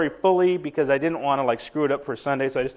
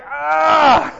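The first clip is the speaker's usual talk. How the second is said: high, loud dopey "ah"; dumb guy's yell; 10 semitones higher, 5 dB louder than usual. Acted. Preacher imitating a guy with no intelligence, loud dim-witted yell. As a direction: mimic a clueless guy's loud cry. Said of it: "true spirit of another guy with no intelligence".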